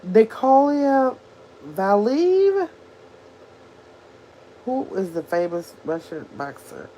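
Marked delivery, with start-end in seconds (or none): 0.0-2.7 higher, tentative stab at "Nikolai Valiyev?"; unsure pronunciation. Real, not acted. Streamer unsure of a Russian boxer's name, sounding it out questioningly. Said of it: "don't know how to pronounce his name".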